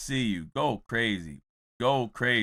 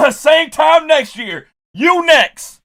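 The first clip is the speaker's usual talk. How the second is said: loud, high, drawn-out call; retold teasing warning; 9 semitones higher, 5 dB louder, much slower than usual. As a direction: call it out to the next kid in line. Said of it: "I'm like".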